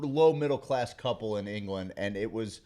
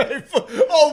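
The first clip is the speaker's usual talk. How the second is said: laughing mock shout; 12 dB louder than usual. Joins the jeering laughing.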